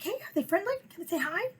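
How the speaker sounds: high bright eager question; eager friendly asking. Retold or imitated. Dog lover asking a stranger on a walk, high and eager. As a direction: chirp it like a keen dog lover. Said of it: "it's like".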